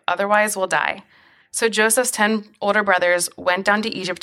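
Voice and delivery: steady, measured Bible reading; scripture reading. Acted. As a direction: read the passage aloud to listeners, evenly. Reads Genesis passage aloud.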